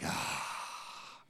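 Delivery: breathy, unvoiced sigh of giving in; husband's whispered sigh of surrender; deflated sigh. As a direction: let the air out, resigned. Deflated reply to his wife.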